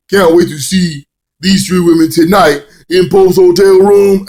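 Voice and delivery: loud, high, drawn-out hype voice; hammed-up lecherous commentary, slowly stretched; Pope commentator impression. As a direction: crow it like an over-excited ringside commentator. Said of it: "the Pope's hilarious commentary".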